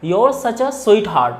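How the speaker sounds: "your" for you are, slow and clear; contracted you're. Shown as a demonstration. Teacher demonstrates you're to students, slowly. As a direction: merge you are into a single your sound. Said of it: "so we will pronounce".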